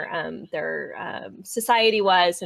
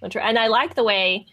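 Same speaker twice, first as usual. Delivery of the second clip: ordinary delivery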